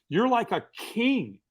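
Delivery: retold awe, stretching "like a king"; insistent repeat. Stranger repeats it for emphasis, retold.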